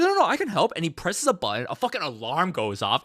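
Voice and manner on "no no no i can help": whiny insisting. Retold or imitated. Gaku insisting he can help.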